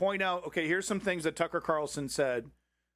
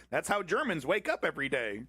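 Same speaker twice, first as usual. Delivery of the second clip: usual speaking voice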